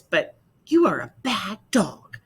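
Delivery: scolding. Acted. Martha scolds Bob.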